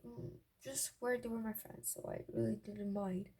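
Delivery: ordinary delivery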